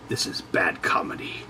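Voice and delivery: usual speaking voice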